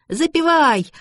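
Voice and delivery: high, loud gleeful call; delighted. A narrator's reading. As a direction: joyfully call for a song. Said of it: "обрадовалась Лота".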